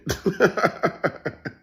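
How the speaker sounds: high, quick staccato cackle; real amused cackle; cackling laugh. Real, not acted. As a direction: crack up in a rapid chuckle. Laughs at his own question.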